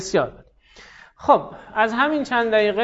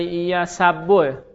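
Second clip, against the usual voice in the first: slow hint; much slower than usual. Shown as a demonstration. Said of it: "من کمکتون کنم".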